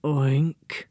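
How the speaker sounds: low, sad pig oink; a gloomy little pig grunt; dejected oink. A narrator's reading. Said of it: "a deeply dejected oink".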